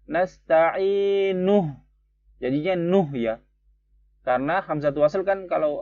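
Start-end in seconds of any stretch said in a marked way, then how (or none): 0.1-1.7 nasta'inuh slow with nuh linked; joined nasta'inuh. Shown as a demonstration. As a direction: link into nuh slowly. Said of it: "kalau mau, teman-teman mau sambung". (nasta'inuh disambung pelan)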